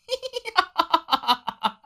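a high, bursting laugh; wife bursts out laughing at her husband's wet bed; hearty laugh. A narrator's reading. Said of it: "哈哈大笑起来". (放声大笑)

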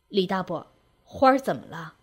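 low, tentative inquiry; probing question. A narrator's reading. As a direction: ask carefully, pitched low. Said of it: "探问道".

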